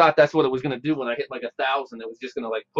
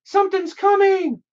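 high eerie voice. Acted. Ominous game warning.

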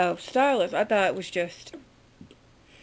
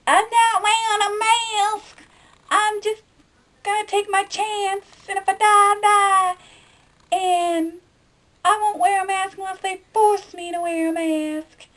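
much higher, loud, put-on voice of a defiant skeptic; mock the stubborn no-mask crowd, high and brash; stubborn anti-masker; 11 semitones higher, 5 dB louder than usual. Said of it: "I was one of those people that".

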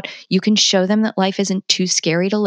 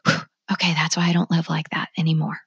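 inner realization. Retold or imitated. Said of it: "I touch it and I go".

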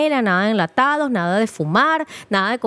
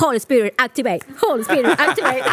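hammed-up preacher calling down power; mock preacher command; 2 semitones higher than usual. Doctor jokingly commands her body like a preacher. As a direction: bless it like a joking televangelist.

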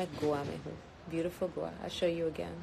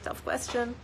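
ordinary talk

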